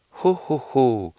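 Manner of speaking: a second, steadier try at Santa's laugh; almost there Santa laugh; slow Santa laugh. Acted. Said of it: "han prøvede en gang til".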